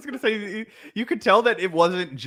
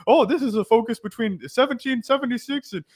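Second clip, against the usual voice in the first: high-pitched mock teacher voice, held up high; put-on high lecturing voice; class announcement; 10 semitones higher than usual. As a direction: imitate a teacher introducing yet another syllabus. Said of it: "it would just be like".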